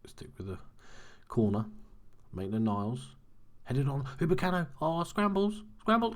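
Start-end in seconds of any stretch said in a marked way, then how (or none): 3.6-6.1 rising higher and louder with the scramble; urgent commentary climbing in pitch; excited play-by-play. Real, not acted. Gamer calling a goalmouth scramble, excited and rising.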